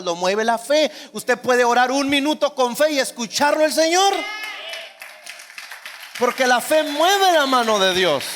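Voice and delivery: sermón a todo pulmón, más fuerte; predicación enérgica. Acted. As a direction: predica con fervor, subiendo la voz. Predica con fuerza creciente. (predicando con fuerza a la congregación)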